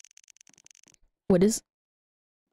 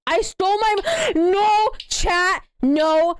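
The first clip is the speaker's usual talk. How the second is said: alarmed no; 6 semitones higher, slower than usual. Real, not acted. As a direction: yelp it out in alarm. Reacts to credit card theft.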